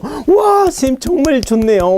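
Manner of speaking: high, drawn-out wow of admiration; hammed-up fan of the teacher; mock gushing student.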